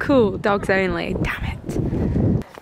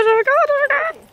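thrilled gasp at the dog; squealing with delight; excited squeal; 13 semitones higher, much faster than usual. Real, not acted. Dog runs agility.

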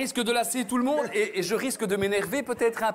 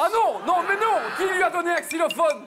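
exasperated protest; 7 semitones higher than usual. Real, not acted. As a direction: cry out in comic despair. Host protests new instrument.

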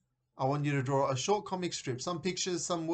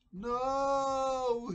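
long, high, held wail; dismayed inner cry; 13 semitones higher than usual. A narrator's reading. Ben dreads the cabbage soup, long high dismayed wail. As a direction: stretch it out high in mock despair. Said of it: "he thought".

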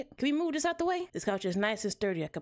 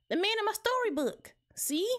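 put-on very high child voice; earnest bunny kid, pitched way up; little kid; 12 semitones higher than usual. Junior shows Mama the book.

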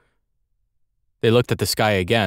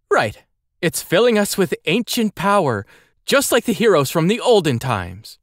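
put-on high, eager young boy voice; 10 semitones higher than usual. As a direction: pitch it up like an eager kid. Andel, eager character.

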